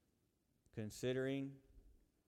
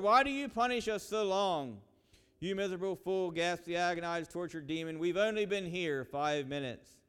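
louder, higher, gripping preacher's tale of hell; dramatic sermon storytelling; 11 semitones higher, 14 dB louder than usual. Acted. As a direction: tell the hell story at full dramatic force.

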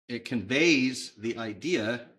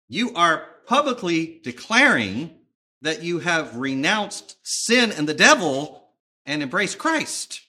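loud, deliberate pulpit challenge with pauses; forceful preacher, building in volume; emphatic preaching; 4 semitones higher, 8 dB louder than usual. Acted. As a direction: press each phrase home to the congregation. Paraphrases Paul in preaching voice.

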